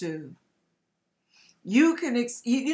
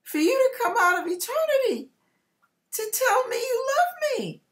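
rising higher and higher into a crying shout; tearful cry to the Lord; 13 semitones higher than usual. Retold or imitated. Thanking the Lord for his love, crying out. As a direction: pour it out to God through tears. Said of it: "and I said".

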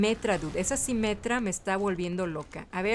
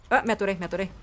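ordinary talk

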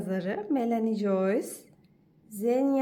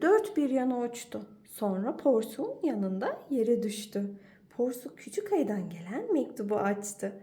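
ordinary delivery, nothing marked

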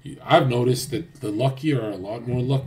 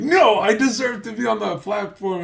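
high, whining protest; high pleading mock breakdown; mock whiny H S; 10 semitones higher, 5 dB louder than usual. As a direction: whine like a spoiled man denied. Mocking H S's breakdown.

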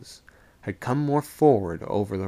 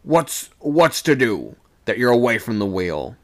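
raised voice, louder challenge; demanding; 2 semitones higher, 6 dB louder than usual. A narrator's reading. Second mate questioning sailor away from wheel, demanding. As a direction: call out a sharp question.

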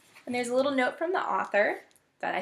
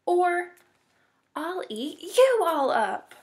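slow, raised, mildly menacing; demanding threat; 7 semitones higher, 4 dB louder, slower than usual. A narrator's reading. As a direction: stretch the threat out, pitched up. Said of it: "Dot demanded".

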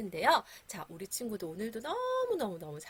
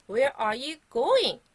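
loud, very slow question for repeating; slow, projected teacher's model; loud model phrase; 4 semitones higher, 7 dB louder, much slower than usual. Shown as a demonstration. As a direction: call it out slowly for the class. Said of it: "따라서 큰 소리로 얘기해주세요".

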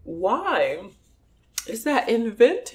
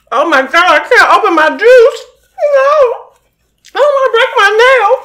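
whiny panic; 11 semitones higher, 10 dB louder than usual. Real, not acted. Panicking over an unopened juice bottle.

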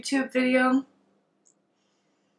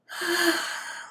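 airy exhale; long sigh; 7 semitones higher than usual. Real, not acted. Noticing sensations while meditating, sighing out.

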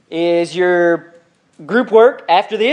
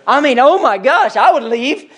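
higher-pitched incredulous reaction; mock appalled; 5 semitones higher than usual. Churchgoer reacting to the preacher's insult, appalled. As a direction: act scandalised, at a loss for words.

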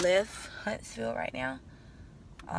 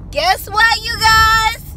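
excited shout; 12 dB louder, much slower than usual. Real, not acted. Excited arrival announcement.

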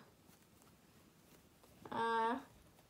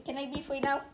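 ordinary delivery

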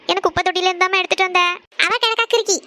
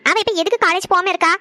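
usual speaking voice

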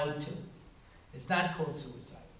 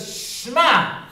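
Shema drawn out; 7 semitones higher, 10 dB louder than usual. Retold or imitated. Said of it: "He goes".

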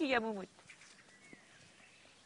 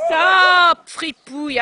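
loud command at the barking dog; shouted stop; 5 semitones higher, 16 dB louder, much slower than usual. Real, not acted. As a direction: yell it to cut through the barking.

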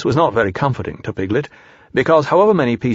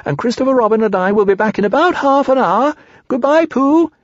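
high, excited squeaky calling; breathless-with-excitement little squeak; Piglet squeaking; 12 semitones higher, 4 dB louder than usual. A narrator's reading. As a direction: call out happily in a tiny animal voice. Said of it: "squeak a last message".

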